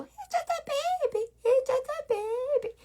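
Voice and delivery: put-on very high, soft baby-talk coo. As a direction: coo over it as if it were a tiny baby. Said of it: "Instead, you go".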